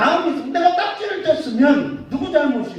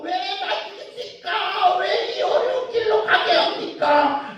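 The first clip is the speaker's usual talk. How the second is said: high-pitched, drawn-out mock protest; resentful complaint; 9 semitones higher than usual. Preacher acting out the people complaining to God, resentful shout. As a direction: wail a grievance up at God. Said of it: "하나님을 원망하고".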